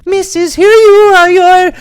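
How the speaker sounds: very high, loud singsong salesgirl; cheery calling out. A narrator's reading. Perfume sales girl brings boxes to customer, calling out. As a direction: sing out the greeting, sky-high.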